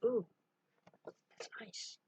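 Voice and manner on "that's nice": whispered aside. Real, not acted. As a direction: breathe it out voiceless. Quiet aside while playing.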